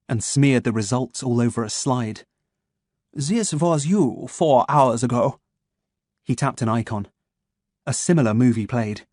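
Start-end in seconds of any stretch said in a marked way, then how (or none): 3.1-5.4 put-on slow, crisp German-accented menace; measured German doctor, each word placed; a calm German voice waking his captive. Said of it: "his German accent crisp and sharp".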